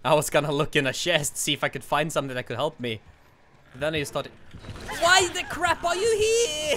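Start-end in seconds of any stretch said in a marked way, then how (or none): 5.0-6.8 exasperated. Real, not acted. Exasperated at killer arrival.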